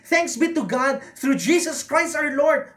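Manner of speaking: joyful triumphant cry; cry of celebration. Acted. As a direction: burst out in thanks. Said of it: "to a cry of celebration".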